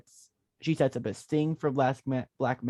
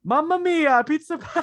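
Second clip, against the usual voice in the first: high, loud hammed-up Italian chef; Italian pizza chef; 6 dB louder than usual. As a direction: belt it like a cartoon pizza chef. Mock Italian sign-off.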